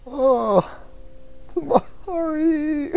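high, drawn-out sobs; words breaking into real weeping; crying. Real, not acted. Gamer moved on seeing Mori, crying. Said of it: "I'm actually crying".

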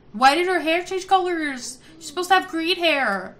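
high, loud exclamation of dismay; indignant disbelief. Real, not acted. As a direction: protest it loudly, baffled. Dismayed at wrong hair color.

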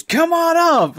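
high, loud summons; preacher calling up. Retold or imitated. Preacher calls him up to the altar, loud and high. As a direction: call him to the altar, full voice. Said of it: "he goes".

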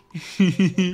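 short gleeful snicker; quick teasing laugh; mischievous giggle. Real, not acted. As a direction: giggle at your own prank. After making friend shake.

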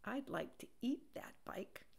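sly whispered wish; whispered thought. A narrator's reading. Goat secretly wanting the bike, whispered. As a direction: whisper it greedily. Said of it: "what he thought was".

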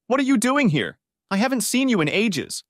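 put-on high, thrilled girlish squeal; excited squeal. A narrator's reading. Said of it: "she squeaked".

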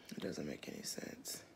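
hushed whisper, almost no voice. Real, not acted. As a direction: breathe it under your breath. Whispered aside while reacting.

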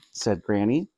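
ordinary delivery, nothing marked

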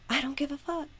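high, loud shouted burst; gleeful shout of not caring; loud exuberant declaration. Shown as a demonstration. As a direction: fling the words up on the in-breath. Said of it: "breathe in and say".

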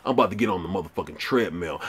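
ordinary talk

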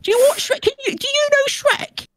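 put-on very high-pitched childish heckler; mocking five-year-old. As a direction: mimic a pestering kid, squeaky high. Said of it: "five-year-olds".

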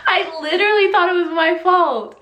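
high, half-laughing wail; real exasperated disbelief. Real, not acted. Relieved, upset at blaming herself.

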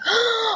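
high-pitched startled gasp. Real, not acted. Surprise en plein jeu. (hoquet de surprise aigu)

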